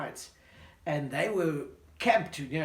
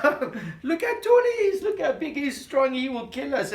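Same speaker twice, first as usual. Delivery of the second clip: laughing; 9 semitones higher, 9 dB louder than usual. Acted. Brothers laugh off David.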